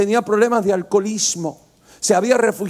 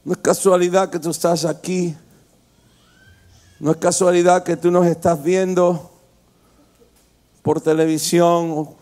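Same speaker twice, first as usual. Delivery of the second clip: slow, lower, hushed prayer voice over music; altar call; 3 semitones lower, slower than usual. Acted. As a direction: draw them gently into prayer.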